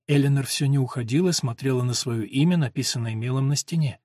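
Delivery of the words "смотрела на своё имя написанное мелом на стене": plain reading voice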